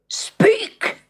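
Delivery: sharp high roar; roared command. Acted. Angry prince roaring at his silent subjects. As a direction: bark the order like an angry prince. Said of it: "he roared".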